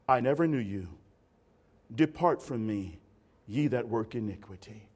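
low, grave rejection; solemn Jesus. Retold or imitated. Jesus rejecting the boastful preacher: low solemn verdict. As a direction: pronounce it like a solemn judge. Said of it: "Jesus' response is".

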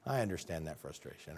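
low, soft sympathetic murmur; mock sympathy. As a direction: fake understanding, hushed and low.

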